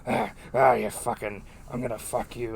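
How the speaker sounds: rough mock English accent, retold. Retelling the caller's threats to a co-host in English accent. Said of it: "in an English accent".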